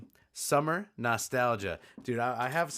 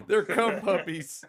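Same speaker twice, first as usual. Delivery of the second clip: laughing; 8 semitones higher, 8 dB louder, slower than usual. Real, not acted. Laughing at the pun.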